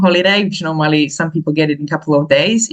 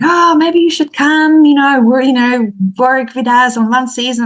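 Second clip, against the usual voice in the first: much higher, eager voice for the friend; friend's high coaxing voice; 7 semitones higher, 4 dB louder than usual. Retold or imitated. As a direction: tempt her with the idea, pitched up. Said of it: "she said to me".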